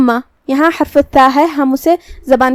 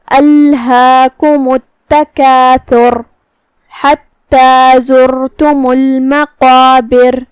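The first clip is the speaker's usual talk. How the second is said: slow, drawn-out melodic tilawat; Quran recitation; 4 dB louder, much slower than usual. Shown as a demonstration. Teacher recites the verse for students, slow melodic chant. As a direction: chant each word long and measured like a qari.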